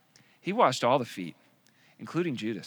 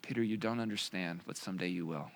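soft, lower repeated reply; gentle Jesus; 7 semitones lower, 10 dB quieter than usual. Retold or imitated. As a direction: quietly reassure him once more. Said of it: "exactly what he did".

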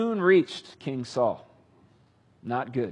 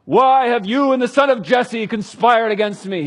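loud, higher, furious demand; angry accusation; 8 semitones higher, 10 dB louder than usual. Acted. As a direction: shout the charge at him. King accuses the priest.

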